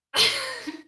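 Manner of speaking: squeaky, high burst of giggling; high giggle. Real, not acted. Streamer overwhelmed by donations, high giggle.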